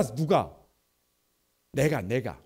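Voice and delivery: normal talking voice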